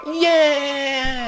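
long, high, loud cheer; hammed-up jubilant whoop; mock triumphant cheer. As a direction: let out a drawn-out victory yell. Character recovers and cheers.

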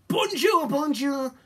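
high, loud greeting from pleased villains; delighted cry. A narrator's reading. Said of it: "cried the two villains in pleasure".

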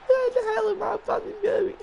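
very high, loud disbelieving shriek; shrieking laughing question; incredulous squeal. Real, not acted. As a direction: screech it in disbelief. Incredulous at own play.

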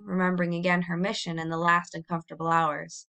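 usual speaking voice

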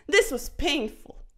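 usual speaking voice

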